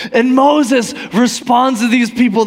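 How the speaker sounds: ordinary delivery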